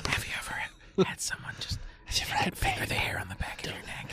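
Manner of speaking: ASMR whisper. Acted. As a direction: breathe the words into the ear. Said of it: "love A S M R".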